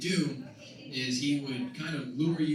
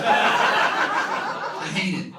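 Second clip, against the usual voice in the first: drawn-out exasperated cry; loud comic groan; 4 semitones higher, 9 dB louder, much slower than usual. Acted. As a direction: stretch it out, hating it. Comic outburst about bathrooms.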